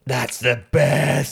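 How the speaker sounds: slow, drawn-out rapture; relived awe. Retold or imitated. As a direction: stretch every word in fan bliss. Reliving awed reaction to the scene.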